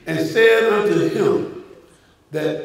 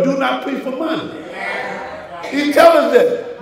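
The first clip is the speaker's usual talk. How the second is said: fiery preaching; 4 semitones higher than usual. Acted. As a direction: build the sermon up, voice lifted.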